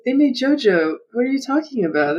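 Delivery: high-pitched playful protest; mock incredulity. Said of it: "I was like".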